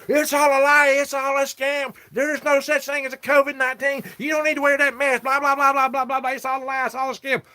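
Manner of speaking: hammed-up imitation of an angry stranger yelling; ranting stranger. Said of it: "He goes".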